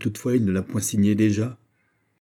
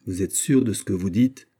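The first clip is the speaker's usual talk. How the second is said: ordinary delivery, nothing marked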